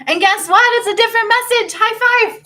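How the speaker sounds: high, loud burst of glee; squealing high-five excitement; excited. Real, not acted. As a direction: celebrate it like great news. Excited big reveal to viewers.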